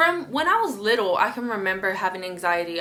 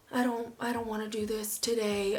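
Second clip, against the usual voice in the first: soft, deflated reluctance, acted; low mood; 8 dB quieter than usual. Mood drops after a few hours, quiet reluctance. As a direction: retell it drained of energy, much softer. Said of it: "real dark".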